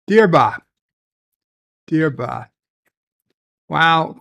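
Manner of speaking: ordinary delivery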